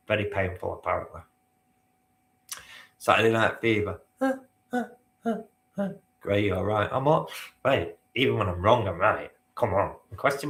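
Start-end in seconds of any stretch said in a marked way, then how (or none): 4.2-5.9 high, rhythmic "huh, huh, huh, huh"; falsetto disco hook. Acted. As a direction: punch out four high disco yelps. Mimics disco falsetto after film title.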